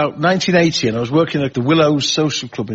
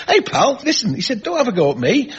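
high-pitched appeal to a heckler; acted comeback, pitched up; imitated heckled comic; 8 semitones higher than usual. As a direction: plead with a heckler to back off.

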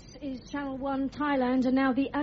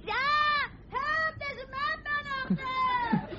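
high, frantic screams for help; hammed-up terrified shriek; panicked screaming; 13 semitones higher than usual. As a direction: scream for help as if fleeing a madman. AOL woman fleeing a gunman.

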